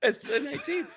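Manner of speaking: high, laughing repeat of the roll; laughing echo. Real, not acted. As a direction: laugh while echoing the number. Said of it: "GM echoes laughing".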